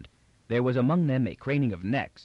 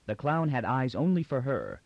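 ordinary delivery

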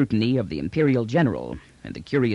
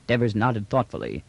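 ordinary delivery

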